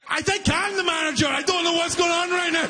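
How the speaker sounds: high, strained mock-panicked cry; flustered panic. Clueless worker asked for the manager, flustered panic. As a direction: blurt it high, out of your depth.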